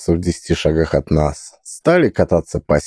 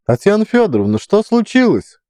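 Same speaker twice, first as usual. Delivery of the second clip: high woman's voice; 13 semitones higher than usual. Acted. Caretaker voiced.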